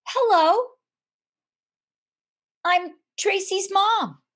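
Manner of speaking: high, slow, sweet and friendly; friendliest voice. Retold or imitated. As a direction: greet him as kindly as you can. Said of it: "with the friendliest voice".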